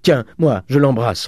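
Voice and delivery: high, loud, impassioned urging; frenzied fervour. A narrator's reading. Father hugging Eugène before his daughter, in a frenzy. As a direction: urge it loudly, carried away. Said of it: "avec une sorte de fureur".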